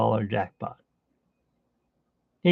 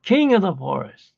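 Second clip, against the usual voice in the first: higher, louder proud declaration; defiant Merida; 7 semitones higher, 5 dB louder than usual. Retold or imitated. Merida defying the contest, higher proud declaration. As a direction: announce yourself boldly, chest out. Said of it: "she was mad".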